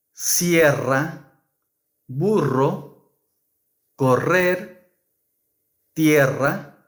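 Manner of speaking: carro, burro, tierra with a trilled rr; rolled r. Shown as a demonstration. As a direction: roll the double r in each word. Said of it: "the strong R must be pronounced".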